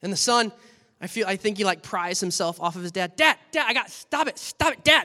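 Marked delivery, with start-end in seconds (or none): 3.2-5.0 high, loud pleading; pleading son. Acted. Son prying free, pleading to dad. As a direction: son begging his dad to stop.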